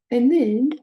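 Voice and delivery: ordinary delivery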